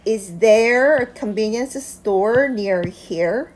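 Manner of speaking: very slow, every word laid out for learners; slow model reading. Shown as a demonstration. English teacher reads the question slowly for students. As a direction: read it slowly so students can follow along. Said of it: "다시 읽어보면".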